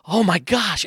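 high-pitched gasp of recognition; astonished mom. Retold or imitated. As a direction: retell a mother's amazement. Mom amazed at the painting.

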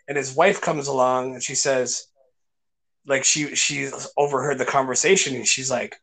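usual speaking voice

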